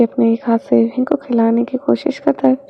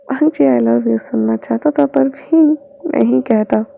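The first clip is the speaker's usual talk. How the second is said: ordinary delivery, nothing marked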